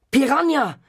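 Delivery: high shout of his name; scream. A narrator's reading. Boy screaming for his fish in panic. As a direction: yell it in sudden panic. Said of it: "schrei ich".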